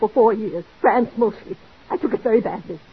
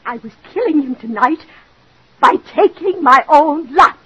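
anguished confession; 4 semitones higher, 6 dB louder than usual. Acted. Confessing suicide plan.

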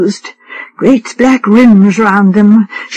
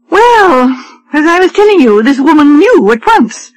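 higher, fussy society wife; a lightly raised voice for a complaining wife; fretful wife; 4 semitones higher than usual. Acted. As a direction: read her as a petulant invalid wife, pitched up.

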